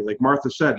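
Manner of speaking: normal talking voice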